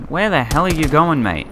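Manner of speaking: exasperated yell at a mate. Real, not acted. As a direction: call out a wayward teammate in disbelief. Exasperated at wandering game teammate.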